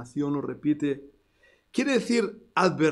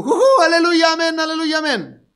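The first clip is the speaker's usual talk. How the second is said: much higher, loud hammed-up jujú aleluya; mock happy hallelujah; 11 semitones higher, 7 dB louder than usual. Preacher mocking what people expect Isaiah said. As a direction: mimic a giddy worshipper.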